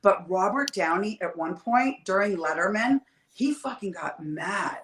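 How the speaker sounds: ordinary talk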